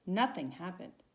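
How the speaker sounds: plain reading voice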